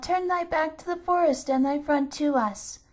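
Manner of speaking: put-on children calling out in unison, commanding the hut; shrill childish call. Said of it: "With their shrill, childish voices".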